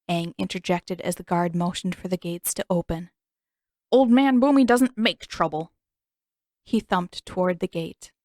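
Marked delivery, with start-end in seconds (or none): 3.9-5.7 hint of an old man, higher and louder; disguised boy bragging as an old man; cranky old man. A narrator's reading. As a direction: puff up as a proud old grump. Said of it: "Aang replied in his normal voice".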